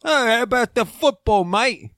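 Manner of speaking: high, hammed-up British football fan; British accent. American pretending to be English to locals, hammed-up British accent. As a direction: play an English footie fan. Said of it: "if you go to London".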